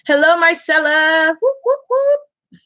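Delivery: high singsong hello with whoops; whooping greeting. Real, not acted. Host greeting a viewer joining the live, whooping. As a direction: hail a friend in a high, playful call.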